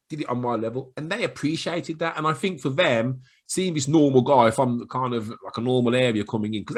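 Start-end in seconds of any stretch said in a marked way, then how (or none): none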